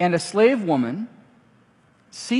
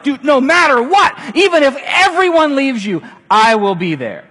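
much higher, loud, passionate promise; fervent vow; 8 semitones higher, 9 dB louder than usual. Retold or imitated. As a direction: promise him like a buddy who means it. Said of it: "He's like".

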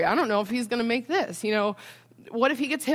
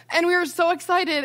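high, louder voice, fighting real tears; choked up; 7 semitones higher, 5 dB louder than usual. Real, not acted. Mother going on with the scan story, choked up. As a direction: keep telling it through the tears, pitched up. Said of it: "I'm gonna get choked up".

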